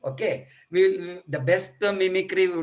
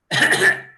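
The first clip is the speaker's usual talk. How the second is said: loud dry throat cough; upper airway cough; 9 dB louder than usual. Shown as a demonstration. Teacher shows the class an upper airway cough. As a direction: show a sharp cough from the throat. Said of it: "I'll just show you".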